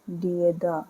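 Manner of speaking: soft sounding out. Shown as a demonstration. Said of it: "sound आएगी द की".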